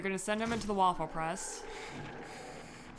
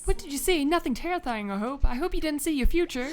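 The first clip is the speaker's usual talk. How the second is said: higher-pitched, worried companion voice; chatty companion; 8 semitones higher, 4 dB louder than usual. Acted. Companion asks what player saw, higher character voice. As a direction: fret in a higher sidekick voice.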